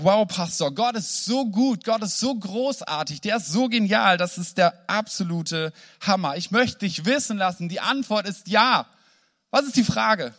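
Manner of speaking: a moved man pouring out his joy to the pastor; overjoyed gushing. Retold or imitated.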